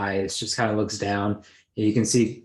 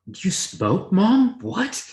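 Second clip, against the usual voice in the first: much higher, incredulous; shocked daughter; 10 semitones higher, much slower than usual. Retold or imitated. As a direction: squeak it in disbelief. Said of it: "Bria's like".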